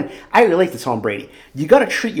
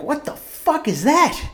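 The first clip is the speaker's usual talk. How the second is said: mock shocked self; 13 semitones higher, slower than usual. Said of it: "I'm like".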